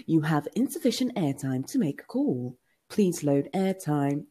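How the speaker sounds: measured recorded-operator voice; automated phone message. Retold or imitated. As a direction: read it out like an airtime warning recording. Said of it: "there was another one I did for a friend".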